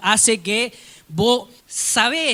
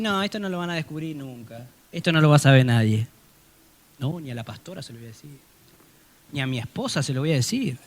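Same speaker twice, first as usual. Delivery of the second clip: hushed secret; 3 semitones lower, 5 dB quieter, faster than usual. Retold or imitated. Voicing a hidden secret.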